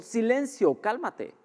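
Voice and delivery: orden aguda y agitada; alterado, alarmado. Acted. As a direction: como recién despertado del susto, mandando callar. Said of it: "no se levantó alterado". (voz alterada y alarmada, actuada)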